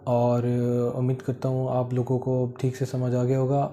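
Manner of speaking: usual speaking voice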